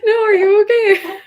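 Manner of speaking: asked through high laughter; laughing. Real, not acted. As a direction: laugh while asking. Laughing with the other speaker.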